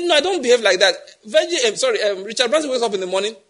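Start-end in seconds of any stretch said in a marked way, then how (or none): 0.0-0.9 Branson's refusal. Retold or imitated. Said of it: "He said".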